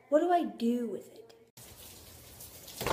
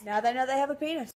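ordinary delivery

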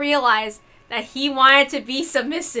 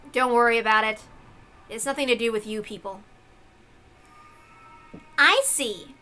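usual speaking voice

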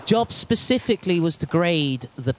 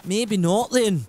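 slow, exaggerated attempt at a Scottish accent; 3 semitones higher, slower than usual. Shown as a demonstration. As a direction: repeat it in a put-on Scottish accent. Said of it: "such a hard accent to do".

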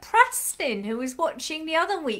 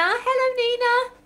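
cheery greeting; 11 semitones higher, 5 dB louder than usual. Real, not acted. Greeting a child watching online.

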